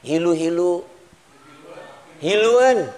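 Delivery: Hilu-hilu then Hiluan, spoken as Sundanese words; Sundanese word demo. Shown as a demonstration. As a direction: say Hiluan clearly, falling at the end. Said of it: "Bahasa Sundanya apa sih?".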